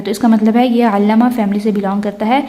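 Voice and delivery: ordinary talk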